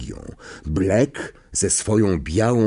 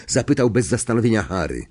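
usual speaking voice

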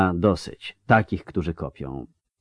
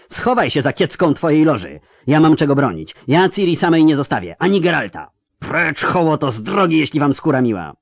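higher, furious and commanding; defiant outburst; 6 semitones higher than usual. Acted. Yennefer refuses to flee and drives back the mob, furious. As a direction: hurl it in fury at the rabble.

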